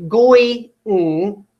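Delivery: GOI-ING slowly broken as "goy", pause, "ng"; wrongly split word. Shown as a demonstration. Teacher showing an impossible syllable split, GOI-ING broken as "goy... ng". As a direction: split GOI-ING after the oy, leaving a vowelless ending. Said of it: "split it here and say".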